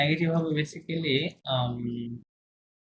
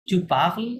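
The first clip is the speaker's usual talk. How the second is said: "tu parles" pitched higher, as a question; questioning intonation; 4 semitones higher, 4 dB louder, much slower than usual. Shown as a demonstration. Teacher demonstrating questions to students, questioning intonation on "tu parles". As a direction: make "tu parles" a question by tone alone. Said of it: "এরকম intonation".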